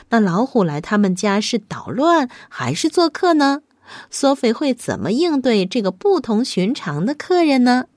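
ordinary delivery

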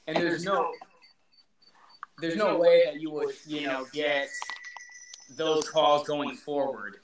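hollow, echoing room sound; like talking from inside a cave. Real, not acted. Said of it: "it sounds like you're in a damn cave".

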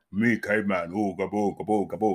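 low grunting caveman. Retold or imitated. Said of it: "a little caveman conversation".

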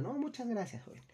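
high, sweet imitation of an older lady; lightly put-on high lady voice saying thanks; grateful lady customer. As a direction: mimic the calmed customer thanking you. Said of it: "Incluso me agradeció".

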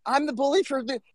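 replaying his stunned reaction to being called a bully; incredulous disbelief. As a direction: pitch jumps way up, baffled and indignant. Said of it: "I'm like".

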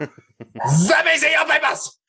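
slow, high mock German guard shout; bad German accent. Co-host mocking German officials, slow high German-accented shout. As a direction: drag out a hammed-up German checkpoint yell. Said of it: "Bad German accent".

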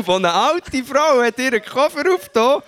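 usual speaking voice

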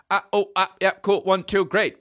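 high, bright mock sound check; mic check demo. Shown as a demonstration. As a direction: test the mic like a roadie. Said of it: "this is the sound check".

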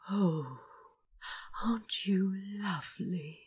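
soft unvoiced whisper. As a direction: breathe it in admiration. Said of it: "he whispered".

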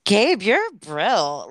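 slow, loud gushing praise. Real, not acted. Cohost gushes over the childhood poem, slowly. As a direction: drag it out with delight.

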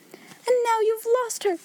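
high-pitched, hysterical reproach; wild cry. A narrator's reading. Hysterical woman blames her sister, wild high cry. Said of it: "she cried wildly".

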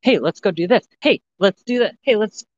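high, eager nagging "hey let's go"; pushy hyped friend. Retold or imitated. Friend pestering an anxious person, high eager nagging. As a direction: mimic someone badgering you to hurry up. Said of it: "coming at me like".

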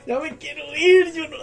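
high mock whine; hammed-up reluctant whine; retold whining. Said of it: "le digo yo, mae".

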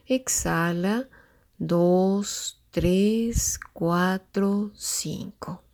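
slow, drawn-out counting of breaths. Shown as a demonstration. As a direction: count each breath slowly and calmly. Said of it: "te tomes cinco tiempos para inhalar".

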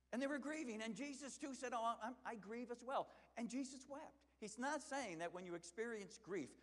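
loud, high impassioned preaching. Acted. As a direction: preach it out with full fire. Preaching at full force.

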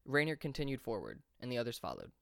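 ordinary delivery, nothing marked

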